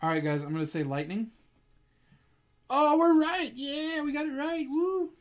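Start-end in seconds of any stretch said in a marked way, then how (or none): 2.7-5.1 high-pitched whoop of triumph; gleeful celebration. Real, not acted. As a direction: cheer a lucky correct guess. Guessed the energy type right.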